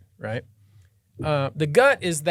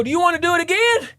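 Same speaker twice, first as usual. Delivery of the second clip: high, fast, gleeful taunt; taunting challenge; 12 semitones higher, 4 dB louder, faster than usual. Retold or imitated. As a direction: dare him to go again. Lawyer taunting the captain.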